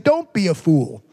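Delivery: low, mild and matter-of-fact; calm reminder, not an insult; just saying. Shown as a demonstration. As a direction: say it gently, no accusation. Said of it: "I'm just saying".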